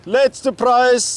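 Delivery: loud, high, slow fish-market barker; market crier. Acted. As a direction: bellow it like a market stall crier. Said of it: "so als Marktschreier".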